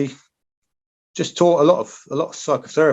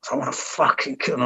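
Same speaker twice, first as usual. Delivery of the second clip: retold snarl; 4 dB quieter than usual. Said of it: "I was snarling".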